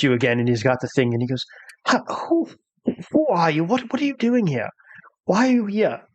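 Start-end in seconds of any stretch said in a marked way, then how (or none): 1.9-6.0 high, jumpy frightened servant; a nervous valet caught off guard; high-pitched startled manservant. Acted.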